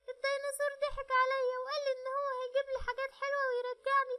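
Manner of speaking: put-on high little girl's voice telling her story near tears; a rescued child sobbing for her mama; tearful little girl. (بنت صغيرة بتحكي وهي على وش عياط وعايزة مامتها)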